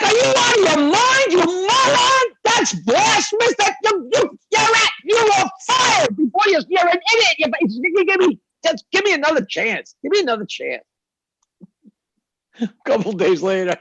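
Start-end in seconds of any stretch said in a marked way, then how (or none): 0.0-10.8 high, loud furious yelling; outraged executives screaming at the ad man; furious boss. Acted. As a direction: blow up at the idiot employee.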